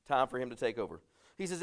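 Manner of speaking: ordinary talk, nothing marked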